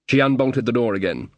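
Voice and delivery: usual speaking voice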